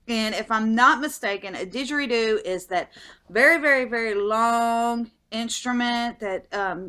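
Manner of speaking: ordinary talk, nothing marked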